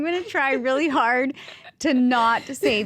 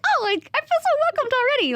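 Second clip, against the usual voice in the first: much higher, sing-song exaggerated gush; gushing mock delight. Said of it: "I was like".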